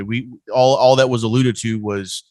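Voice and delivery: usual speaking voice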